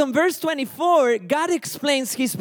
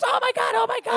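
much higher, squeaky mock panic; panicky running chicken; 11 semitones higher than usual. Preacher mimicking panicked believers as running chickens, high mock panic. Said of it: "like running chickens".

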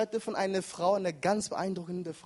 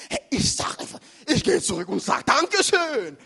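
higher, spontaneous outburst; impulsive instinct voice; 6 semitones higher, 7 dB louder than usual. Acted. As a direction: blurt it out as an eager impulse. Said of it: "Manchmal sagt unser Instinkt".

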